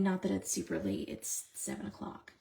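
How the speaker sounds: usual speaking voice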